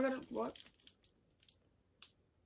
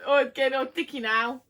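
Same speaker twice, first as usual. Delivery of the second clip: usual speaking voice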